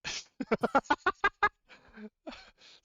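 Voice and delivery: big burst of real laughter, falling off; loud laugh. Real, not acted. Player joke makes GM laugh.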